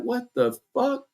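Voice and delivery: mock disbelief. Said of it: "and I be like".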